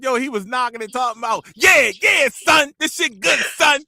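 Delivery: loud, high mock New Yorker; loud, high New York accent. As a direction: shout it like a New York guy. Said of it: "She talking about".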